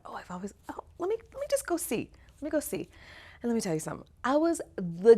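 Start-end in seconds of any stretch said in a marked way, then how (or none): 0.1-0.8 breathy unvoiced whisper to herself; hushed secret wish; whispered thought. Inner thought before auditioning. 1.0-2.9 much higher, quick and eager; high, hurried self-encouragement; eager thought. Retold or imitated.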